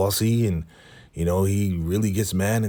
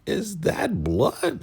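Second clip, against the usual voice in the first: shocked question; 12 semitones higher, much slower than usual. Retold or imitated. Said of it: "She's like".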